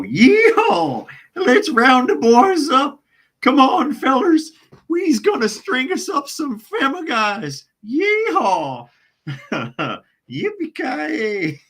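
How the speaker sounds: high, loud exaggerated hillbilly holler; exaggerated Southern hillbilly accent, hollering. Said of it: "I do a good hillbilly accent".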